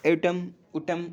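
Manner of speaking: slow, careful "एटम, ऊटम" contrast; teaching pronunciation. Shown as a demonstration. Teacher slowly contrasting two sounds for students. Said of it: "next word है ऊटम".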